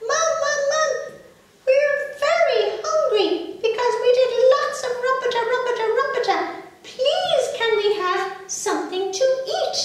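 put-on high, loud little baby pleading; a small child begging mum, pitched high. Baby asks mum for food.

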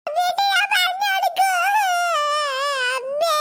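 bawling threat to tell; childish crying. Acted. Child threatening to tell mother, crying. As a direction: bawl it, stretching out the endings. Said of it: "ഒന്ന് കരയാതെ മോളെ".

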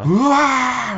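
high, drawn-out whoa; imitated crowd roar. Comedian imitating the audience's reaction to the boy, high roar. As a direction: let a long cheer soar up.

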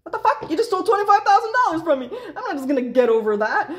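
mock-furious shriek at the thief, half laughing; a laughing, high-pitched mock rage; outraged disbelief. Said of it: "And you're like".

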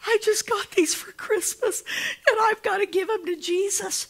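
put-on high little boy voice; a light child's voice giving his shoes. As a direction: voice an eager ten-year-old. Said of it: "a little ten-year-old boy".

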